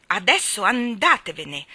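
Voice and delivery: loud, firm order; stern command. A narrator's reading. Mary Poppins sends the birds away, stern command. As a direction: order them out firmly and loudly. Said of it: "comandò severa".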